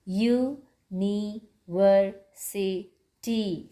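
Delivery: "university" slowly in five parts, u, ni, ver, si, ty; syllable by syllable. Shown as a demonstration. Teacher counting syllables for children, "university" slowly broken in five. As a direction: pause after each syllable of "university". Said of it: "five syllables".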